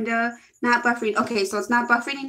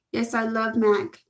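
ordinary talk, nothing marked

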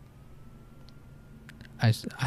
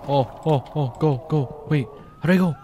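usual speaking voice

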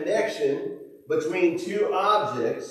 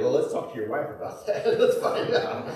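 teasing with laughter; 2 semitones lower than usual. Pretend. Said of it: "would be".

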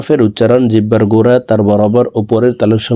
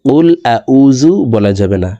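normal talking voice

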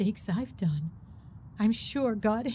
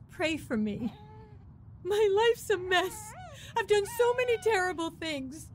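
tearful; 10 semitones higher than usual. Acted. Confessing her sins, near tears.

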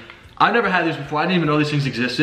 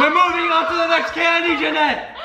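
high, raised call. Real, not acted. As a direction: call her over, pushing. Calling partner to move on.

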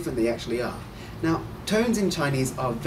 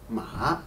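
"ma" dropping low, then rising; dipping third tone; 3 semitones lower than usual. Shown as a demonstration. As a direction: dip the pitch on "ma" and bring it back up. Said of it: "lower your voice and then you raise it".

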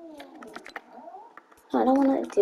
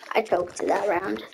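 much softer, almost to himself; quiet; 3 semitones lower, 10 dB quieter than usual. Real, not acted. Streamer repeating his mistake, muttered softly. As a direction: drop the volume and say it under your breath.

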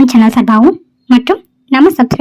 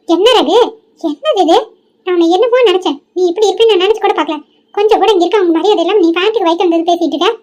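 hurt grandmother; 5 semitones higher, 4 dB quieter than usual. Acted. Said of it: "இங்க பாரு பாட்டி".

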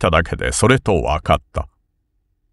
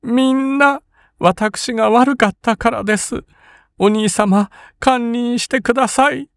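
sobbing sister. A narrator's reading. Kin sobbing apology.

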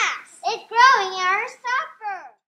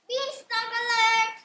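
high-pitched emergency call; acted alarm; 5 semitones higher than usual. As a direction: call out a red alert to the herd. Herd raises alarm.

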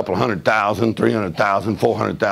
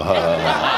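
low, taunting fake laugh; mock laugh at the devil; 8 semitones lower, slower than usual. Shown as a demonstration. Preacher showing the congregation how to mock the devil, fake laugh. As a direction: laugh in the devil's face, mockingly. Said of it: "Somebody just laugh at that devil and go".

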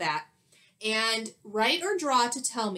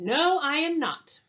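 mock indignant teacher, higher and louder; teacher denying it; 6 semitones higher, 3 dB louder than usual. Teacher insisting to the class, mildly indignant. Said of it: "And she's saying".

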